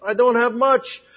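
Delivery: lifted, slow plea of a man short on faith; a hint of a desperate man begging; pleading cry. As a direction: cry out to the Lord for help, drawn out. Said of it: "the man that cried out".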